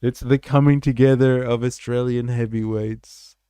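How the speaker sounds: loud, announcer-style hype; hyped announcement. Real, not acted. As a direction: give it up like a ring announcer. Hyping the guests' arrival.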